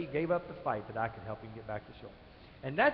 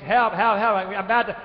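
loud, high mock cry for help; retold scream for help; 4 semitones higher, 11 dB louder than usual. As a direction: call out like a drowning man. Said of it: "and he screams".

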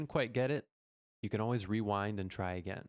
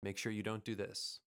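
ordinary talk, nothing marked

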